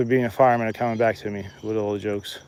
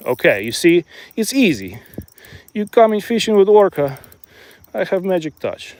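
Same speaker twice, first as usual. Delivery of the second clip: put-on Russian accent; 5 semitones higher than usual. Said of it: "stop the Russian accent".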